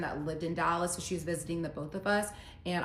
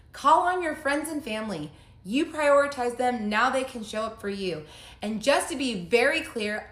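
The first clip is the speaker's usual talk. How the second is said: higher and louder, reading the text with outrage; indignant loud reading; 5 semitones higher, 7 dB louder than usual. Retold or imitated. Reading her ex-husband's final text to viewers, outraged and loud. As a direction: belt out his cruel text like an accusation.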